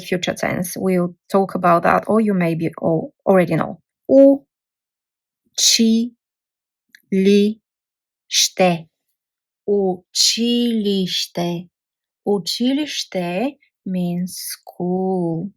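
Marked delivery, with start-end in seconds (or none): 4.1-8.9 slow four-syllable breakdown. Shown as a demonstration. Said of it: "Four syllable". 9.7-15.5 "Učilište" drawn out slowly as oo-chee-lee-shteh; slow careful Bulgarian word. Shown as a demonstration. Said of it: "Four syllable".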